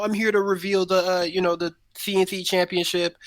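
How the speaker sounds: put-on high-pitched take on a celebrity's accent; Mike Tyson's accent. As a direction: slip lightly into the boxer's accent as you announce. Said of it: "you said it with his accent".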